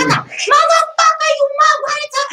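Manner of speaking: high, loud mock screaming gamer; imitated gamer yelling. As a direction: scream it like a furious gamer at night. Gamer screaming wakes her up.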